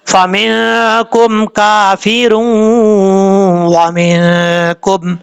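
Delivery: slow chant, held nasal join from كَافِرٌ into وَمِنْكُمْ; recitation with ghunna. Shown as a demonstration. Teacher showing how it is read, slow nasalised recitation. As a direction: recite slowly, humming the end of كَافِرٌ. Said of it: "اس طریقے سے پڑھا جائے گا".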